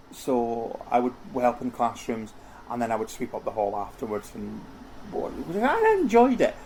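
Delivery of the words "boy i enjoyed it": high-pitched burst of real delight; sudden higher gleeful exclamation. Real, not acted. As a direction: lift the voice right up in joy. Fond memory of first job.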